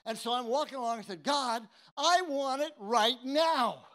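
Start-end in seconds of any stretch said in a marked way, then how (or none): 1.2-3.8 slow, loud demand; demanding prayer. Retold or imitated. New believer demanding the Spirit from God, slow and loud. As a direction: insist on it now, drawn out.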